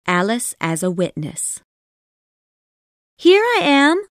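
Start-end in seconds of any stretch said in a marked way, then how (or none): 3.2-4.1 high, loud eager call; excited cry. A narrator's reading. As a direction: jump up and call out. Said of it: "cried Alice".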